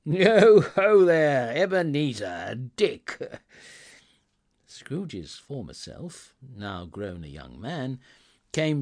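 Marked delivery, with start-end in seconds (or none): 0.0-3.4 oily, fat, jovial holler, drawn out; big comfortable boss's booming halloo; rich, jovial call. A narrator's reading. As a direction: call out loudly and comfortably to the lads. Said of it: "called out in a comfortable, oily, rich, fat, jovial voice".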